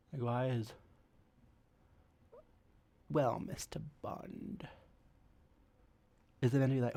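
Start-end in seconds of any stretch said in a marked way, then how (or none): 3.1-4.7 slow, whispered spy-movie vixen; breathy villainess. Retold or imitated. Spy-movie villainess greeting Bond, slow and whispered. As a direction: breathe the line like a femme fatale. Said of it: "villainous vixen of a woman".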